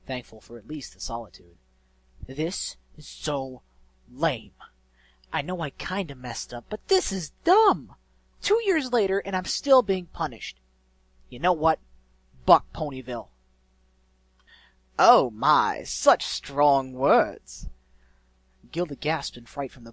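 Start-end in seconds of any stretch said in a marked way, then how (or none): 2.3-13.3 loud, indignant griffin outburst; frustrated rant. A narrator's reading. Punished griffin complaining to herself, loud frustrated rant. 15.0-17.7 loud, drawn-out mocking villain voice; sly mysterious villain. A narrator's reading. Unseen voice startles the griffin, slow theatrical mockery.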